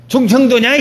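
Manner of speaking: high, loud mock reproach; impatient jibe. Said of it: "이러지".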